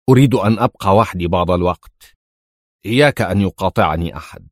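understated angry command; angry order. A narrator's reading. Jackson demanding to be left alone, mildly angry. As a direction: order them off with a touch of anger. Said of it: "وهو يصيح في غضب".

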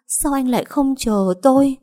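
crying to her dead fiancé; sobbing cry. Acted. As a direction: cry it out through tears. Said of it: "khóc như điên như dại".

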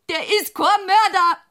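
loud, high protest; fierce denial. Acted. Widow defending the accused man, a loud high outburst. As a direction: burst out defending him.

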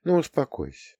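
put-on soft, tender murmur to a child; soothing mother. A narrator's reading. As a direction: hush a crying son gently. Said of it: "стала гладить его по голове, приговаривая".

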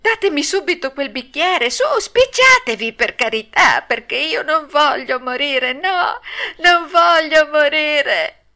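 high, loud, tearful pleading; wailing puppet in terror; panicked shrieking. A narrator's reading. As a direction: beg wildly for your life. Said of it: "cominciò allora a strillare il burattino".

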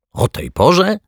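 very high incredulous question; high, scandalised query; incredulous challenge. A narrator's reading. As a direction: throw it back in disbelief. Cleaner challenges him in quarrel.

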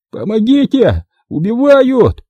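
very high, panicked yell; cry for help. A narrator's reading. Puzyr, trampled in the snow, yells for help. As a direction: scream for help as if being killed.

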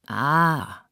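a drawn-out, admiring "ah"; delighted crowd cry. A narrator's reading. Guests as the poet rises, collective cry of delight. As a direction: react like an expectant dinner party. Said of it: "Alles rief".

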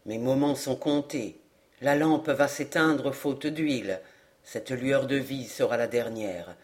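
low, soft dying elder; serene. A narrator's reading. Dying old man announces his last moments, serene. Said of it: "répondit Chariclès avec sérénité".

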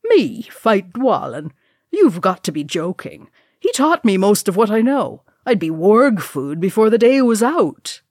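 dwarf's emphatic, swooping disbelief; incredulous Gimli. Acted. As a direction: protest the very idea with hearty disbelief. Gimli scoffs at the bet.

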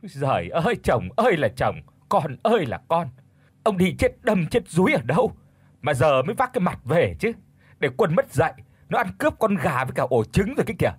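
acted wailing, angry outburst; roaring tirade. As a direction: berate your useless husband at full tilt. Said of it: "lại gầm lên".

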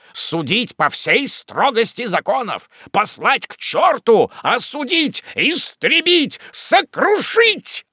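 mounting fury. A narrator's reading. Wardle vows to crush the scoundrel, mounting fury. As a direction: build from firm to high, slow shout.

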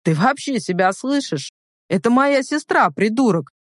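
indignant outburst, pitch climbing; furious rebuke, moderately voiced; angry growl. A narrator's reading. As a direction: growl it in a protective brother's anger. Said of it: "прорычал брат так страшно".

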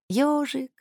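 put-on high little lisping girl's voice; lisping child. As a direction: lisp it like a small child. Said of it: "смешно шепелявя".

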